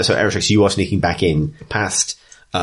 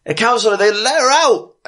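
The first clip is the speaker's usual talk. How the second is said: much higher and louder call across the street; guard shouting; 4 dB louder than usual. Acted. The guard shouting to the counselor about the escape. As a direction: raise the alarm to the counselor. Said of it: "the guard says".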